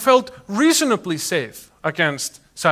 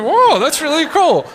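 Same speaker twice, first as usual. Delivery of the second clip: slow, high, loud wowed "whoa"; imitating a delighted, awed reaction; delighted admirer; 10 semitones higher, 6 dB louder, slower than usual. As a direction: marvel like an impressed amateur hacker. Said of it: "was delighted to see us".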